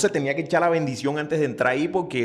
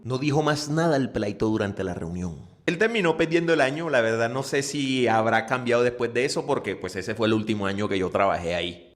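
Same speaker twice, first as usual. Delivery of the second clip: ordinary talk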